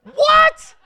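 very high, loud shriek of surprise; shocked what. Real, not acted. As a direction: yelp it in total disbelief. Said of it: "reaction to flaming arrows law".

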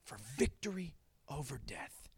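low, hushed, almost whispered; hushed repeat. Real, not acted. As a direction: drop to a reverent near-whisper. Softly repeats the praise line.

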